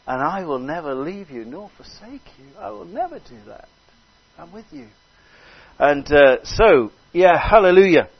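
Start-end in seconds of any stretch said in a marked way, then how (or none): 0.0-4.9 hushed, swooping voice of divine reassurance; the Lord. Acted. God reassuring abandoned Abraham, softly voiced with swooping pitch. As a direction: promise it softly, drawing out the words. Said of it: "And the Lord says".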